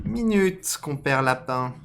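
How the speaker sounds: high, loud fox call; reworked fox voice. Acted. Fox hailing Compère Lapin, high loud character voice. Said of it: "c'est un renard".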